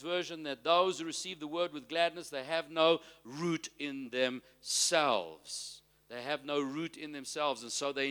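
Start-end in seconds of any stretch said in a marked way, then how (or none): 2.3-5.8 slow, drawn-out delivery; slow emphasis. Real, not acted. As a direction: stretch out "root" and "themselves" for weight. Preacher stresses key verse phrase.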